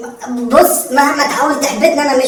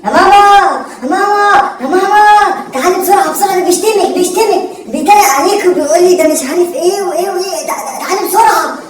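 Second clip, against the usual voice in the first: put-on higher, louder childish tattling call; whiny kid shouting for mother; calling mom; 5 semitones higher, 3 dB louder than usual. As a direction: yell for mom like a kid telling on someone.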